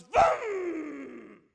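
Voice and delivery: loud revving engine noise; engine vroom. Acted. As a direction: rev it like a motorbike. Olga starts the moped.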